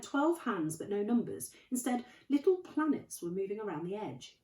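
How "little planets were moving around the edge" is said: usual speaking voice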